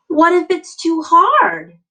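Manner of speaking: high, loud anxious question; worried question. Acted. Teacher voicing a child's doubt, high and anxious.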